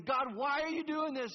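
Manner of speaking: high, loud, slow accusing cry; demanding shout at God. Shown as a demonstration. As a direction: fling the question at God.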